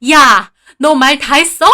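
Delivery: mother-in-law losing her temper, moderately loud; furious yell. A narrator's reading. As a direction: snap out a challenge, outraged. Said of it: "분에 못 이겨 소리를 지르시더라고요".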